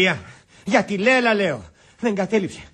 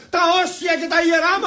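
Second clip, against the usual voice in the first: high, ranting climax; indignant shouting; 6 semitones higher than usual. Acted. Betrayed visitor ranting to the general, shouting. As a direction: declaim the outrage at full pitch. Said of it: "Μη φωνάζεις".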